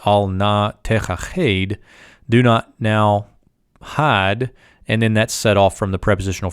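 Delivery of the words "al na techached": slow syllable-by-syllable Hebrew, "Al na techached" spelled out in sound; careful Hebrew reading. Shown as a demonstration. Teacher demonstrating the Hebrew slowly to students. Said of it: "So here's the subject predicate".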